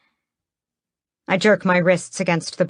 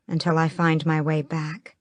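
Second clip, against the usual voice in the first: ordinary delivery, nothing marked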